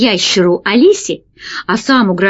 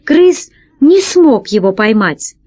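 ordinary delivery, nothing marked

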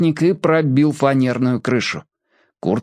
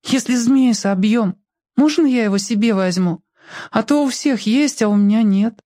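put-on small boy voice; 9 semitones higher than usual. A narrator's reading. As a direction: ask shyly like a little boy. Small boy asks shyly.

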